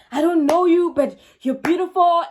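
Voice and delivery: high, loud mock declaration of admiration; gushing suitor. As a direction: imitate an over-eager admirer laying it on thick. Voicing the gushing suitor's promises.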